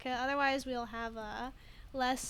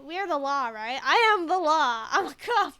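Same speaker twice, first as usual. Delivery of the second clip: belting out a proud cop boast; hammed-up loud cop bragging; 3 semitones higher, 12 dB louder than usual.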